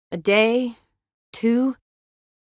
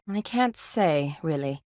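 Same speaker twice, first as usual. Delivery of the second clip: ordinary talk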